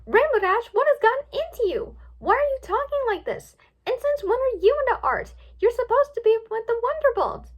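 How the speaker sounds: high, loud scolding; indignant outburst. A narrator's reading. Twilight confronting her changed friend, indignant outburst. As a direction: fire off questions in high-pitched disbelief.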